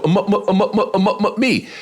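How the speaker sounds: high, repeated blocked syllables before one word; imitated stutter. Heckler at the back of the club, imitated stutter. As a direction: stammer over and over until the word lands. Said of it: "I hear this voice go".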